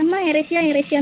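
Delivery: put-on high, loud little-child voice; imitating the toddler. Mother imitating her toddler spotting a plane, high child voice. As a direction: mimic the baby pointing at a plane. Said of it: "എന്ന് പറയും".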